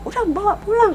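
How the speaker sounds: high-pitched mock angry order from the husband; husband angrily ordering them to take the child home; retold angry husband. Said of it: "Ini bapaknya marah".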